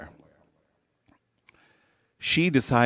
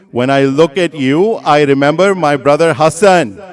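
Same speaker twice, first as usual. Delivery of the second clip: loud, raised preaching voice; impassioned sermon; 8 semitones higher, 12 dB louder than usual. Acted. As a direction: retell it as a fervent lament, pressing on key words. Preacher retells the Karbala dialogue with force.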